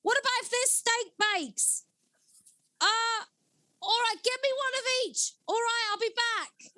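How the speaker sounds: high, carrying answer over the street; neighbour calling back. Retold or imitated. The neighbour calls back a Greggs order, high and carrying. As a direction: shout your order back without really raising your volume. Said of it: "to a neighbour".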